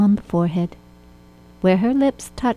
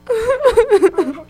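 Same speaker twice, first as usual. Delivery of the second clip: high hammed-up wail of sobbing; mock weeping; 5 dB louder than usual. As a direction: blubber like a crying Munchkin. Munchkins begin to weep.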